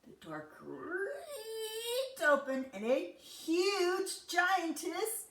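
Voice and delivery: slow, high creaking delivery; creaky voice. A narrator's reading. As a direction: stretch it like a creaking door. Creaky sound effect narration.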